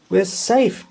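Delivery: high, bright sigh of relief; relieved exclaim. Acted. Boy stops the chariot, exclaiming in relief. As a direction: let it out, glad. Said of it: "George exclaimed in relief".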